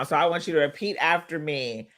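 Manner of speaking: ordinary delivery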